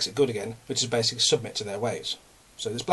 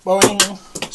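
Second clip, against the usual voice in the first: much higher, loud cartoon boing; spring it out like a cartoon spring; boing sound effect; 9 semitones higher, 11 dB louder than usual. Shown as a demonstration. Showing the pen spring off his belly, a loud boing. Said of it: "this is how bad it is".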